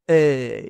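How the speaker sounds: "E" slow with an open mouth; open e demo. Shown as a demonstration. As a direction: open wide on "E". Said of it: "Abrimos la boca un poquito más hacia arriba". ("E" abierta, despacio)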